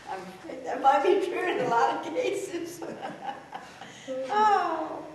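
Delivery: high, loud laughter through the words; cracking up with delight; laughing hard. Real, not acted. Host laughing hard at joke.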